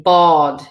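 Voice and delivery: "bod" slow, with a long aw; long stressed A. Shown as a demonstration. Teacher's example word for boat, long vowel. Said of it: "it makes it longer".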